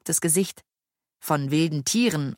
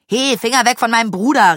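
fast, loud retort; quick, raised-voice warning; angry shout; 6 semitones higher, 7 dB louder, faster than usual. A narrator's reading. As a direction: shout back in defence of a brother. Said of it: "rief Tim".

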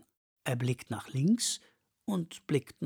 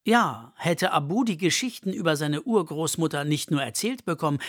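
ordinary delivery, nothing marked

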